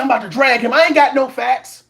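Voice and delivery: ordinary delivery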